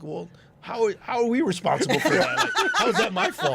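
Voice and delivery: high, laughing mock indignation; retold protest. Promoter answering drivers who blame him for traffic, mock-indignant protest.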